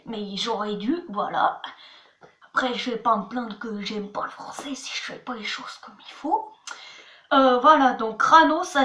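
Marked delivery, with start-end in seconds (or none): 2.5-6.5 fast whispered self-reproach; quick whisper to yourself; whispered aside. Real, not acted. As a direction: mutter it fast under your breath. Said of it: "whispered aside".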